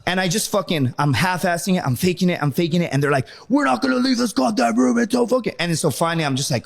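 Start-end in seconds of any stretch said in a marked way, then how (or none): 3.5-5.6 high, sustained instructor rant; imitation of instructors yelling a threat; mock screaming. Drill instructors refusing to leave, high mock yell. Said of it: "they're screaming at me".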